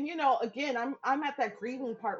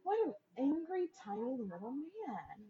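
mocking baby talk; patronizing cutesy mockery; mock cooing; 5 semitones higher than usual. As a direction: coo at him condescendingly. Mocking the angry brother.

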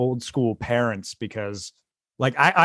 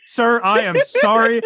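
apologetic dad; 6 semitones higher than usual. Retold or imitated. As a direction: play an earnest apology for laughs. Retells joke, dad apologizing to stranger.

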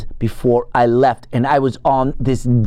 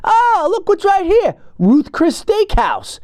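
much higher, slow, sing-song discovery; delighted high mock discovery; bright idea voice; slower than usual. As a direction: announce the steakhouse like a brilliant plan. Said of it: "you know what I come up with".

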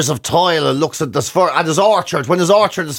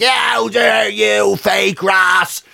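slow, higher mock indignant outcry; slow, raised voice of offended Instagram commenters; mock outrage; 4 semitones higher, slower than usual. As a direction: ham up the online outrage at fake grass. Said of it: "They were like".

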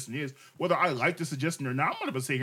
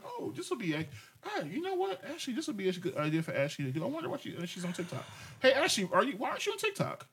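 much higher, softer sing-song musing; lightly voiced musing; 6 semitones higher, 6 dB quieter than usual. Husband reenacts his own innocent thought watching TikTok, sing-song. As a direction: muse aloud innocently, drifting up. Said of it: "I'm like".

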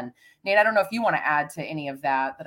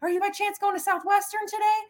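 high friendly call out. Retold or imitated. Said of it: "And I said".

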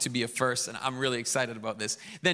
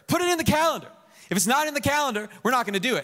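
loud, high, firm insistence; insistent; 10 semitones higher, 5 dB louder, faster than usual. Retold or imitated. Pastor insists to his wife, loud and high. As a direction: hammer it home to her. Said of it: "And I said to her".

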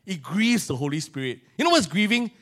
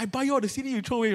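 mild mock angry mother; 6 semitones higher, 5 dB quieter, faster than usual. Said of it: "My mother was so angry".

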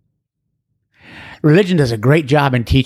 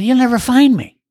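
put-on higher, frantic voice of a drunk man on the edge; desperate caller; 8 semitones higher than usual. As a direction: sound hopeless and panicky. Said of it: "He said".

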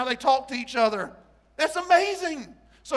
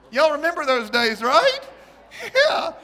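much higher, louder, laughing; 6 semitones higher, 10 dB louder than usual. Real, not acted. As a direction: laugh through the words. Laughing at own impression.

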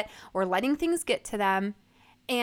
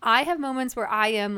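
ordinary talk, nothing marked

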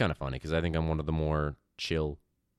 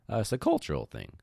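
voice cracking; 4 semitones higher than usual. Real, not acted. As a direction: voice cracks up high on cultural. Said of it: "My voice just cr".

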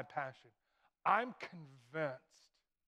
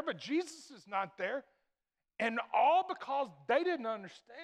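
high, loud pulpit preaching; preaching at full force; 6 semitones higher, 6 dB louder than usual. Acted. Pastor pressing the congregation, high and loud. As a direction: drive the point home from the pulpit.

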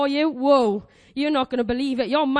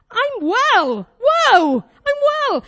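amazed; 6 semitones higher, 5 dB louder, much slower than usual. Acted. Models amazement at being well.

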